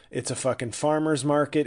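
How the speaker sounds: normal talking voice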